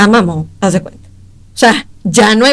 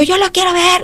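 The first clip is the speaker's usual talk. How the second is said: pleading; 9 semitones higher, 4 dB quieter than usual. Retold or imitated. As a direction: plead in a thin-volume high wail, retelling it. Retells pleading to see father. (súplica aguda, recreada sin gritar)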